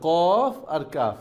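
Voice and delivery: কফ and কাফ said very slowly, to set the two letters apart; slow contrast demo. Shown as a demonstration. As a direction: spell out কফ আর কাফ slowly for learners. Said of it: "উচ্চারণ আপনি বুঝতে হবে".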